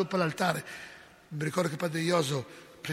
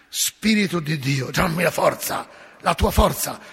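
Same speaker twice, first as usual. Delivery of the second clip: forceful prayer; 7 dB louder than usual. Retold or imitated. Priest praying over possessed girl.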